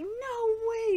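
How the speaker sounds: a touch of an indignant shout, high; mock angry shout. Retelling Taka refusing about his brother, mock indignant. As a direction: play Taka's little outburst, half-hearted. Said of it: "he's all angry".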